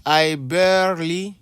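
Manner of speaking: I barely said slowly, rising; teacher gives an example sentence slowly. Shown as a demonstration. Lead into I barely slowly, rising. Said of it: "si tu veux donner une définition".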